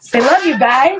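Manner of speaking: grandma feeding grandson a line; coaxing. Real, not acted. Prompts toddler line.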